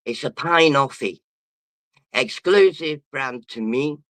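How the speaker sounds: ordinary talk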